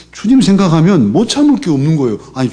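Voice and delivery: usual speaking voice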